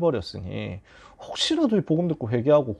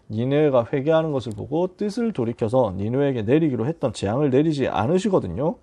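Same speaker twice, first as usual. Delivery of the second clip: normal talking voice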